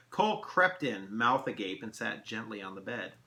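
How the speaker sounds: plain reading voice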